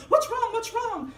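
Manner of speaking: put-on high, loud frantic cry of a scared kid; panicked boy. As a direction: shout it in a panic, pitched high. Boy panicking at dog's screams.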